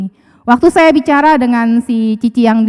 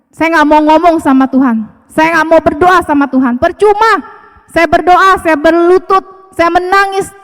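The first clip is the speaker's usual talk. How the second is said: higher, whiny voice of a fed-up believer; a believer grumbling at God, higher and sulky; sulky complaint to God; 5 semitones higher than usual. Retold or imitated. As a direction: play the tired one who has given up on prayer. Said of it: "kalau kita lagi marah sama Tuhan".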